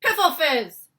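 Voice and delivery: very loud, high shouted insult; giant's shout. Acted. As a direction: bellow the nonsense curse. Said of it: "shouted the Blood Bottler".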